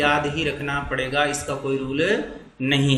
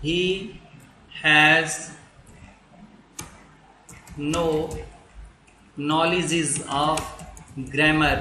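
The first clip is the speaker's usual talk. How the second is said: each word slow and spaced, with long pauses; slow dictation; much slower than usual. Shown as a demonstration. Teacher dictating a sentence, slow and spaced. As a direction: spell it out for students to write.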